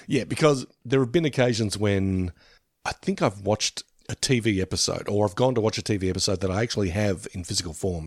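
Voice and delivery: ordinary talk, nothing marked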